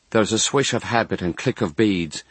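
ordinary delivery

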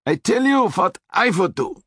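shouted over a car horn, high; shouting Austrian accent. A narrator's reading. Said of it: "he shouted over the blast".

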